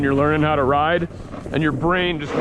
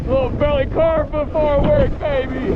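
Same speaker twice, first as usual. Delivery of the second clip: shouting over wind; 10 semitones higher than usual. Real, not acted. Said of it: "hear me over the wind".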